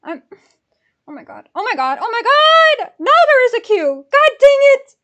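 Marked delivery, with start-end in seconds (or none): 1.1-4.9 from normal voice up to high, loud yelling; frantic exasperation, building. Real, not acted. Ticket buyer finding a queue after failed payment, frantic. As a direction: escalate into a panicked, frustrated shout.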